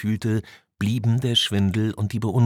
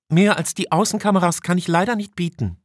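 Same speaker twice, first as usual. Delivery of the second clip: much higher female officer voice; 12 semitones higher, 3 dB louder than usual. Acted. Officer Tanaka reports an outage to her commander, high-voiced. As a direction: answer as the officer, pitched high.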